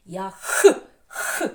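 Я, then forceful rasped Х puffs; raspy Х demo. Shown as a demonstration. Teacher shows which sound rasps, Х huffed out. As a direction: puff out a hoarse, isolated Х. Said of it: "ще з ними хрипить".

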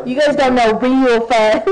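loud, mock-superior teasing. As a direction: taunt them playfully. Teasing in a put-on voice.

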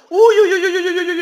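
high, loud panicked yelps; alarmed yelps. Real, not acted. Gamer spotting enemies inside, alarmed yelping.

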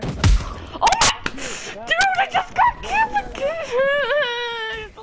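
very high, drawn-out wail; high whining cry of frustration; anguished whine. Real, not acted. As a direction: wail in despair at another death. Wailing at another in-game death.

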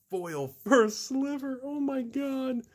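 high, drawn-out squeal of amazement; awed disbelief. Real, not acted. Card opener marveling at his best pull, high awed voice. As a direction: stretch it slowly, voice way up high.

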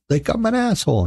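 very high, mock-offended voice; exaggerated high comeback; retold retort. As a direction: squeak it out as a put-on complaint. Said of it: "And I said".

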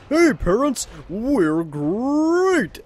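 high, bright cartoon-tiger greeting; greeting the parents as Tony, up and eager; chipper Tony. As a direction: burst in cheery and high.

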